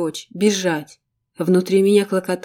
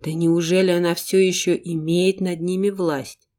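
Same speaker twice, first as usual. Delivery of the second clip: ordinary delivery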